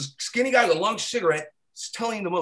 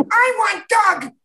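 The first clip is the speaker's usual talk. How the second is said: much higher, slow, louder whine; high whiny demand; 7 dB louder, slower than usual. Retold or imitated. Circus girl demanding Doug, high slow whine. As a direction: draw it out like a sulky child.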